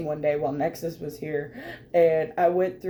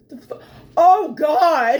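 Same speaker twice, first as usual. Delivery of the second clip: higher, louder startled curse; shocked exclamation; 7 semitones higher, 7 dB louder than usual. Retold or imitated. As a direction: blurt it out, stunned. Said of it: "I'd be like".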